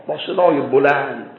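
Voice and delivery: usual speaking voice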